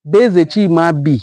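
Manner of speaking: usual speaking voice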